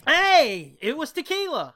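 loud, high burst of recognition; triumphant loud exclamation; excited outburst. Real, not acted. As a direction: shout it out, suddenly vindicated. Realizes friend named the bottle.

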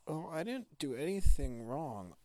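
put-on small boy's plaintive protest; hurt little boy. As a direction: plead it like a wronged kid. Story boy protests his innocence.

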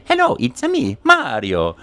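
high Italian-accented cartoon plumber; Mario voice. Shown as a demonstration. Showing how he talks to children, Mario voice. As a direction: greet them as Mario. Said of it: "just like that".

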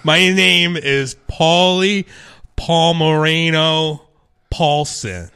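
slow, spaced-out name announcement; grand self-introduction. Acted. Volcano owner finally gives his name, slow grand announcement. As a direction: draw out each name with a pause between.

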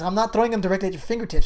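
ordinary talk, nothing marked

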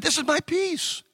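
high, lightly voiced offering; voicing Jesus. Preacher voicing Jesus offering his peace, light high pleading voice. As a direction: hand it to them eagerly. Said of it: "he said".

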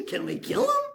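put-on an overexcited kid begging permission; mock eager squeak. King asking Elisha if he can kill the blinded enemy, eager. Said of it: "Said Elisha".

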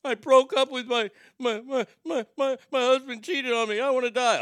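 over-dramatic sob story, stumbling on the words; hammed-up heartbroken caller; mock distressed caller. Mock callers to a suicide spot.

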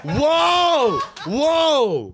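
high amazed whoa. Real, not acted. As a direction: exclaim in amazement. Reacting to accent.

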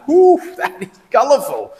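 high, loud ironic "Whoo" for a violent kind of "colourful"; hammed-up whistle of alarm at "colourful"; mock alarmed whoo. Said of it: "but in the".

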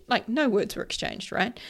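ordinary talk, nothing marked

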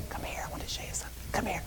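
put-on soft playful whisper calling the girls over. Dad on the couch whispering to his young daughters. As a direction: coax them closer in a hushed little voice. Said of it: "I would whisper in this little voice".

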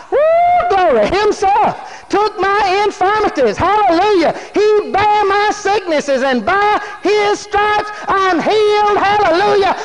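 high, loud, exultant preaching; shouting praise. Acted. Believer giving glory to God, shouting in faith. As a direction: shout out faith in long, ringing phrases. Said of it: "You go to shout".

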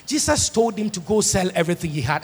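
usual speaking voice

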